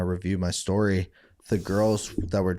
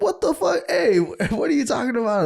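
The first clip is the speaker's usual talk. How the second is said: much higher incredulous outcry; mock shocked friends. Friends startled by his cheek, high shocked outcry. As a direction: squeal it like you can't believe him.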